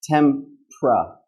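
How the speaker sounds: TEMPRA in two split beats, TEM, pruh; reduced consonant cluster. Shown as a demonstration. Teacher shows how Americans shorten it, reduced consonant cluster. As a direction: run P straight into R in TEMPRA. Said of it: "So we go".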